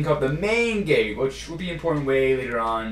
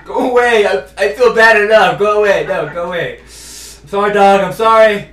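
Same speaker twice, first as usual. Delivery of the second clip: loud, higher, flustered shouts at a dog; guilty shouting; 5 semitones higher, 12 dB louder than usual. Real, not acted. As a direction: yell at the dog while apologizing.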